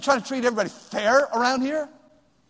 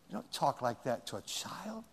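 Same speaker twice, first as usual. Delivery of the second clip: whisper; 5 semitones lower, 14 dB quieter than usual. Real, not acted. Hushed rebuke after demo yell.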